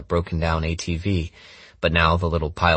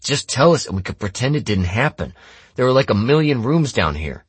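mildly snarling, raised voice; pressing accuser; 7 semitones higher, 4 dB louder than usual. Acted. Kittredge pushes Jamie to confess, mildly angry. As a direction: press for a confession with a touch of heat.

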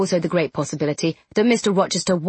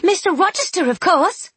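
high eager exclamation; excited; 11 semitones higher, 3 dB louder than usual. Acted. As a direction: announce it brightly.

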